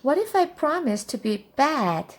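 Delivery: put-on high child's voice; bargaining little witch. A narrator's reading. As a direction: offer a deal as a little girl.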